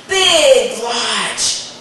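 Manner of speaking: plain reading voice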